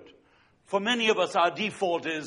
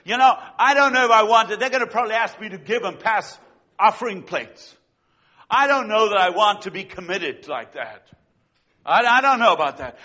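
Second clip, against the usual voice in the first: hammed-up reluctance; 6 dB louder than usual. Mock reluctant churchgoer.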